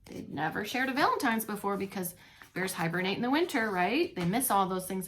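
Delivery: ordinary delivery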